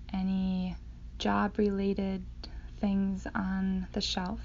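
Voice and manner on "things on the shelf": ordinary delivery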